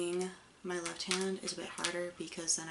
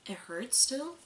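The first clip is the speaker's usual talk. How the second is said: normal talking voice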